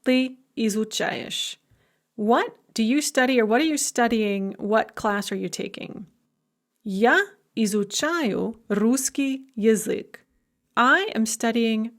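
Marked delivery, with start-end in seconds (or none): 0.1-1.6 slow, clear teacher pronunciation; careful model question. Shown as a demonstration. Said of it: "So we could ask, for example". 6.8-10.2 slow, clear teacher pronunciation; slow learner-friendly reading of "Я изучаю русский язык."; careful model sentence. Shown as a demonstration. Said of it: "for example".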